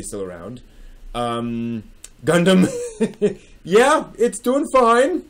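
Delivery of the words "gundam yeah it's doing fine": laughing through the words, pitched higher and slowed; amused disbelief, giggling it out. Real, not acted. Laughs at failed prediction.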